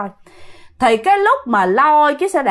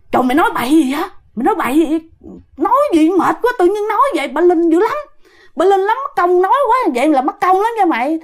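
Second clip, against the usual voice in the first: higher-pitched, urgent telling-off; alarmed scolding; 6 semitones higher than usual. Retold or imitated. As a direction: shush a careless friend in a worried pitch. Scolding aunt for tempting a spirit. (cao giọng gắt, can ngăn)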